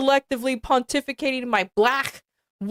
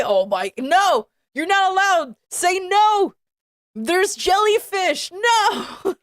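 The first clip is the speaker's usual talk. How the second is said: mock panicked pleading; 6 semitones higher than usual. Real, not acted. Yelling at the fanfic character not to leave, mock panic. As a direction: shout no at the character as if to stop her.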